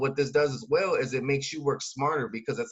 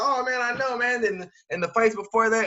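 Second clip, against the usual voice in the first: much higher and louder, all fired up; hyped fight fan; 9 semitones higher, 4 dB louder than usual. Acted. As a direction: gush about the knockout like a fan. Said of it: "He's like".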